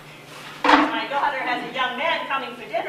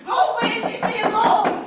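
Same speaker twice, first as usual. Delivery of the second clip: higher and louder, pushing him away; frantic shout; 8 semitones higher, 5 dB louder than usual. Acted. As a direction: yell at him to go, panicked. Mother shuts son out.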